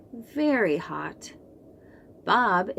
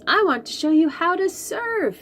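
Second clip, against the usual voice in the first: calling out; 9 semitones higher, faster than usual. Acted. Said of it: "he yells".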